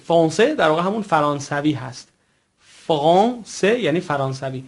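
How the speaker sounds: usual speaking voice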